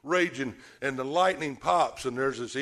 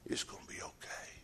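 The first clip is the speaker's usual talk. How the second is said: whispered, much softer comfort; 13 dB quieter than usual. Acted. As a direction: whisper it gently to calm them. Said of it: "Jesus just softly speaks".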